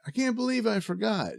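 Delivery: high, disbelieving gasp of a line; a man suddenly remembering; mock shocked Norman; startled realization. Norman finds his old hideout.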